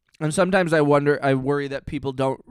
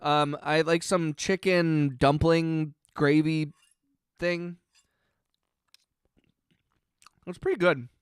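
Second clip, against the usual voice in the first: mouth full; 4 dB quieter, slower than usual. Real, not acted. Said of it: "sorry to eat on stream into the microphone".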